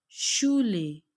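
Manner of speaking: शूले with a clear sh onset; teacher says शूले; German sch sound. Shown as a demonstration. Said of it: "एससीएच जो है वो शीन की sound देता है".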